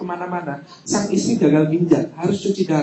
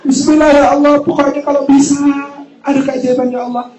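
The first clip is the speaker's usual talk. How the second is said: louder, higher hopeful plea; fervent plea; 6 semitones higher, 4 dB louder than usual. Retold or imitated. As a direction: beg with your whole heart. Fervent plea before the wedding. (doa penuh harap, lebih keras)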